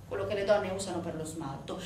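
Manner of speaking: usual speaking voice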